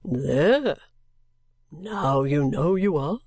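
low, very slow character voice; eccentric gentleman. Acted. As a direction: answer slowly and deep, swooping on the last word.

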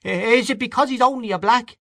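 high, pointed challenge; sharp question. A narrator's reading. Freddy challenges the diners, high and pointed. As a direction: needle the table with the question. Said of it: "asked Freddy Malins sharply".

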